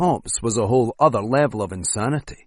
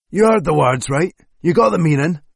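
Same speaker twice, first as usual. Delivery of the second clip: louder, higher threat to the captive; menacing raised voice; 6 semitones higher, 5 dB louder than usual. Acted. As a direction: lean on him hard.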